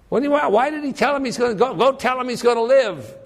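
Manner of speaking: incredulous. Acted. Preacher voices the incredulous question.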